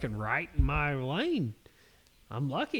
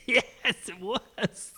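laughing; 9 semitones higher, much slower than usual. Real, not acted. Agreeing with driver, laughing.

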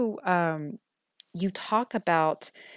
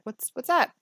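what's up; 8 semitones higher, 4 dB louder than usual. Retold or imitated. Mother gently asks daughter.

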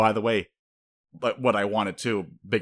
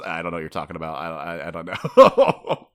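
mock denial; 3 semitones lower, 6 dB louder than usual. Denial ending in laugh.

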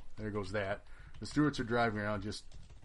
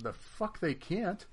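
higher, slow, drawn-out disbelief; indignant; 9 semitones higher, much slower than usual. Retold or imitated. Arguing the officer rule with the opponent, slow and indignant. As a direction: stretch every word in disbelief. Indignant retort over rules.

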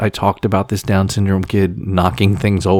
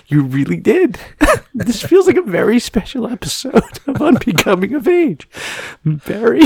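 high, wobbling voice between laughs and sobs; crying and laughing at yourself at once; tearful giggling, pitched way up; 14 semitones higher than usual. Real, not acted. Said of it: "wanna know why I'm crying".

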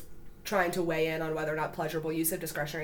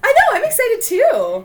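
excited; 12 dB louder, slower than usual. Real, not acted. Gushing back about being excited.

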